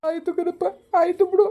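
high, whiny sulking; sulky whine. Acted. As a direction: give in with a high hurt whine. Son sulks after being scolded. (ಎತ್ತರದ ದನಿಯಲ್ಲಿ ಮುನಿಸು)

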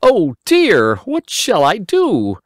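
put-on high, dismayed boy voice; a little boy at a loss; Simple Simon crying out. A narrator's reading. Simon lamenting his leaky sieve, high boyish cry. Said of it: "cried Simple Simon".

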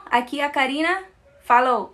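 ordinary talk

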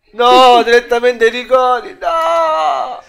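loud frustrated shout; yelling no at the screen; explosive italian cursing. Real, not acted. Match going to penalties. (urlo esasperato)

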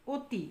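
অতি said as 'o-ti'; closed o vowel. Shown as a demonstration. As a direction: start অতি with a rounded 'o'. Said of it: "ও এর মতো হয়েছে".

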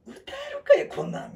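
high, loud fed-up protest; exasperated outburst. Retold or imitated. Alone after hearing Leo, protesting high and exasperated. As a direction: throw up your hands at the song. Frustrated with hard song.

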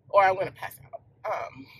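tearful, voice catching at the end; fighting back tears as it hits her; choked up. Real, not acted. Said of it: "that is making me emotional".

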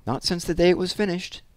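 lightly voiced foreign old lady; old lady, foreign accent. A narrator's reading. Old lady appraises her childhood portrait, faint foreign accent. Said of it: "with its faint foreign accent".

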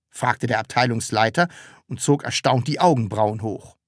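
ordinary delivery

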